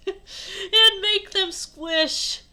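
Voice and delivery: slow, high, loud and drawn out; hammed-up quote. Shown as a demonstration. Reader replaying the silly line, exaggerated and drawn out. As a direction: stretch "squish" out, high and loud.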